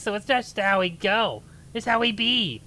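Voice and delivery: ordinary delivery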